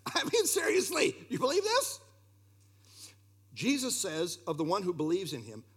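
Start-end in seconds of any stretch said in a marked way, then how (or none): 0.1-2.0 high-pitched, half-laughing insistence; high, playful challenge to the audience; seriously asking. Acted. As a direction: press the question in a raised pitch. Presses the question after laughing.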